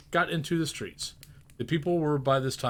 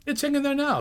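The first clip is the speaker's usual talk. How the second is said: put-on much higher, boyish astonishment; amazed boy; 14 semitones higher, 5 dB louder than usual. The boy asking about the giant turkey, amazed. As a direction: gape at the size like a lad. Boy's voice.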